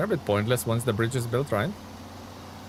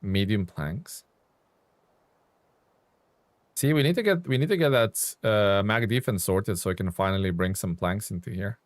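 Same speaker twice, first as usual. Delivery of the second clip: usual speaking voice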